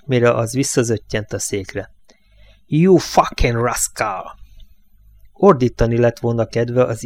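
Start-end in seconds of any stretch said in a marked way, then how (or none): 2.6-4.2 slow furious hiss through clenched teeth. A narrator's reading. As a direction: spit each word out, barely holding back rage. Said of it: "sziszegte összeszorított fogakkal". (összeszorított fogakkal, lassan sziszegve)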